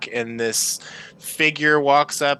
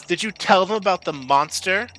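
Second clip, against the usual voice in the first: accusing question; 6 semitones higher than usual. Acted. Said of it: "the half-elf says".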